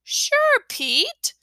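high, eager little brother pleading; a small boy begging to go back; little kid voice. A narrator's reading. Fudge voiced.

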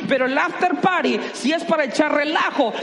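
loud, high shout on party; excited shout. Acted. Pastor hyping the after party to the church, excited shout. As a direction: whoop it up on party.

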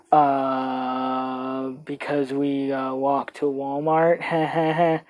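soft, slow sheepish mumble. Retold or imitated. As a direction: drag out the lame excuse quietly. Sheepish answer to mom.

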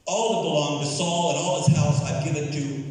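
commanding announcement; acted royal decree. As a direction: proclaim it as a king to a servant. King's decree to servant, acted.

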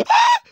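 loud startled gasp then yelp; retold gasp and yelp. Kid gasping as frog falls from hands. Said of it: "and I was like".

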